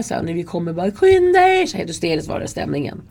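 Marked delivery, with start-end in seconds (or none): 0.9-1.7 high panicked yell of the crowd; hysterical shout. Retold or imitated. Teenagers on the platform yelling at the guards, hysterical shout. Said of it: "ungdomar och skriker".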